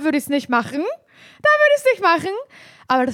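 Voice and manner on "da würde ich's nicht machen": the warning repeated much higher and slow; drawn-out, high mock warning; hoch und langsam gesprochen. Shown as a demonstration. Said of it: "um Leute zu warnen … und zu sagen". (hoch und gedehnt wiederholt, als Warnung)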